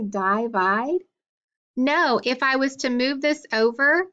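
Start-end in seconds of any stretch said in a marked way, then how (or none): 0.0-1.0 "divide" asked as DIE-vide; long I mispronunciation. Shown as a demonstration. Teacher asking students about the wrong form, long-I "divide". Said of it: "Do we say divide?".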